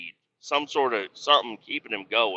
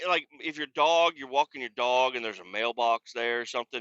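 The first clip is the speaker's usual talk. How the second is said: ordinary talk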